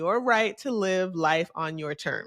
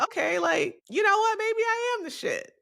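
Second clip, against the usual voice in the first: self-hype rising high into a squeal; hyped-up inner voice; 13 semitones higher than usual. Retold or imitated. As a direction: pitch climbing way up, higher and higher. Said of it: "I'm like".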